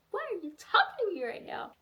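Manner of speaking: very high, incredulous demand; indignant snub. Acted. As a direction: squeak it out in mock indignation. Acting Sadie snubbing Lavinia.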